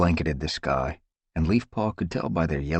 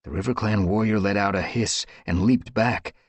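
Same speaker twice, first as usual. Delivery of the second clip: ordinary delivery, nothing marked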